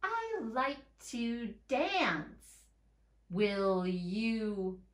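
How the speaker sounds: each word drawn out, slow; careful slow read-aloud. Shown as a demonstration. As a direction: read it slowly for children. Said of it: "go back and read".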